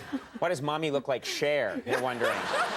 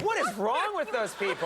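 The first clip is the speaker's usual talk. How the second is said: mock-baffled onlooker, pitched up; higher, incredulous question with a laugh; mock dismay; 6 semitones higher than usual. Onlookers at a bar judging the flirting pair, mock dismay.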